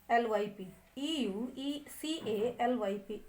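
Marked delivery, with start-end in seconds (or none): none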